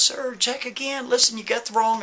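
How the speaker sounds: high-pitched, pleading with the officer; begging the booking officer to check again; pleading Kevin. Retold or imitated. Kevin pleads with the officer, high and urgent. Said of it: "He goes".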